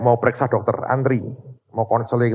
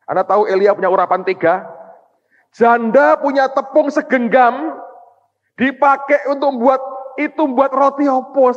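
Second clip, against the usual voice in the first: high, loud preacher's voice, slowed; full-force sermon delivery; fiery preaching; 4 dB louder than usual. Acted. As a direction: drive the story home to the congregation.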